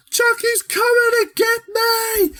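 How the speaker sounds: very high, slow, panicked squeal; terrified kid. Retold or imitated. Andy seeing Chucky climb the fire escape, screaming in panic. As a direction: shriek it like a scared little boy. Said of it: "he's like".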